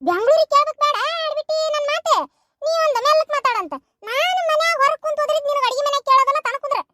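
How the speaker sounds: high-pitched, huffy comeback; testy elder's high-pitched protest; indignant retort. Acted. Scolded for loudness, argues back.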